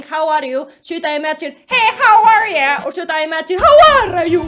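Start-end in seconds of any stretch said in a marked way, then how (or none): 1.7-2.8 loud, drawn-out American greeting; American accent. Acted. Imagining Jerry as American, hammed-up American accent. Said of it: "if he has an American accent". 3.6-4.4 loud, higher mock Italian greeting; Italian accent. Imagining Jerry as Italian, exaggerated Italian accent. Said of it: "American accent or Italian accent".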